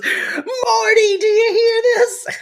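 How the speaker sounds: high-pitched wail to a husband; mock outraged wail. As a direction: cry out in hammed-up outrage. Acting out outraged mother calling Marty.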